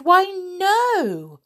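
very high, loud princess protest; protesting cry. A narrator's reading. Princess defends the dwarfs to the guard, crying out. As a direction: exclaim in earnest denial.

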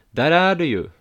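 loud, high hail across the yard; calling out. A narrator's reading. Friend spotting the couple at the gate, loud call. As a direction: shout it to catch a friend's attention. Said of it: "ropar Aslak".

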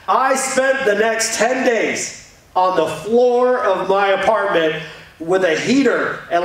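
normal talking voice

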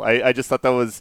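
ordinary talk, nothing marked

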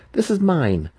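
high, insistent demand. A narrator's reading. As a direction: claim it sharply as his own. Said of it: "Massage demanded".

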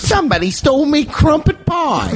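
very high British urchin voice; mock British accent. Host playing robbed Brit, high British whine. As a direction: squeal it as a wronged Brit. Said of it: "do a British accent".

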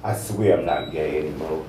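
normal talking voice

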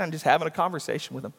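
usual speaking voice

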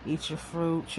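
normal talking voice

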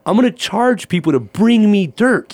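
high and loud, gleeful reveal of the dirt plan; excited exclaim. Retold or imitated. Telling the land agent his dirt-charging plan, gleefully excited.

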